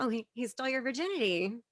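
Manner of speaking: quick, offhand, barely concerned; mock indifference. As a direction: toss it off as no big deal. Mocking police indifference, contrasted with alarm.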